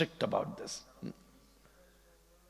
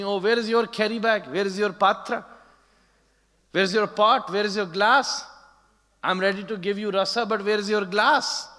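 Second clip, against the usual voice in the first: raised, higher insistent questioning; demanding; 5 semitones higher, 4 dB louder than usual. Retold or imitated. Nanda Baba demanding the devotee's glass, raised and insistent. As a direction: press each one, loud and sharp.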